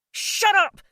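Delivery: high loud yell. Acted. Jay losing it, yelling at Felicity to shut up. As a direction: yell it to cut her off. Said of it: "he yelled at her".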